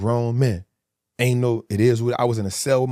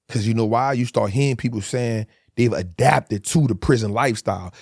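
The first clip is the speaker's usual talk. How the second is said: usual speaking voice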